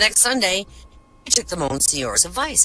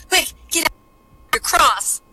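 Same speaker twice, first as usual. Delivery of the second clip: terrified nun; 10 semitones higher than usual. Acted.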